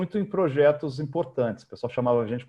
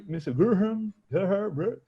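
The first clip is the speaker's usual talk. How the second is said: higher, guessing at the name; mock mispronouncing caller; 6 semitones higher than usual. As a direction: fumble the name like a stranger. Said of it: "tentava ler meu nome". (imitando alguém que erra o nome)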